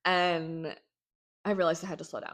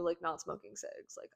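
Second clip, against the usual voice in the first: ordinary talk, nothing marked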